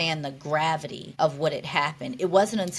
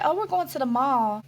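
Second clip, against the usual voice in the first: higher-pitched, casual sister announcement; imitated sister; 7 semitones higher than usual. Sister telling patient about a mall trip, casually upbeat. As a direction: lightly voice a sister sharing plans.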